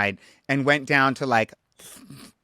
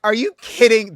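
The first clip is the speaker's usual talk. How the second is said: outraged quote; 9 semitones higher, 8 dB louder than usual. Retold or imitated. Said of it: "I was like".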